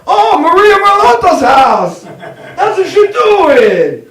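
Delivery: very high, loud, drawn-out exclamation; mock delighted shriek. Mother spots Maria's house in the sand, hammed-up excited shout. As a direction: whoop it like an overjoyed Italian mother.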